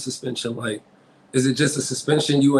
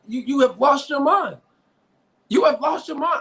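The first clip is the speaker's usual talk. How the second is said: heated outburst; 8 semitones higher than usual. Real, not acted. Heated argument about coach's words.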